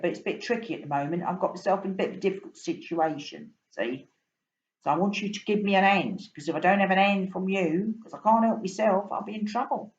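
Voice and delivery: a cockney fish pleading for a hand; London fish begging the boy for help; London fish asking for help. Acted. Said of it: "You can tell I'm from London".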